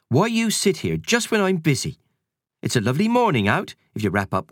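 brisk housekeeper voice; busy cleaning lady shooing kids; Missus Kersey voice. Acted. Housekeeper scolding the children.

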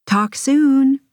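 high, singsong sign-off; called-out goodbye. A narrator's reading. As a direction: call it out over a bad connection. Calling goodbye on bad connection.

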